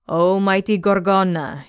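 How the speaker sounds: plain reading voice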